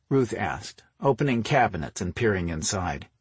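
ordinary delivery

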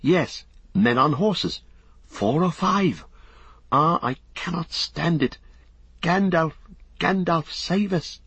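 high, desperate plea; mounting panic, pitched high; crying out in fear. A narrator's reading. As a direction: plead for rescue in a high, frightened voice. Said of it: "he cried".

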